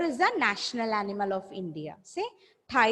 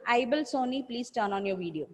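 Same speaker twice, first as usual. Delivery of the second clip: ordinary delivery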